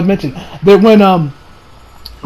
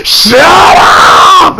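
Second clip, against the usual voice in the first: loud, high shout of shut up; angry snap; 5 semitones higher, 5 dB louder than usual. Retold or imitated. Vegeta silencing the doctor, loud angry shout. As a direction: bark it at the annoying guy. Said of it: "Vegeta's like".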